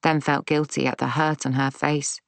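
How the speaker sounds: plain reading voice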